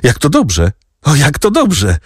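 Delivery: put-on high, rising delight of a boy. A narrator's reading. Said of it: "ucieszył się chłopak".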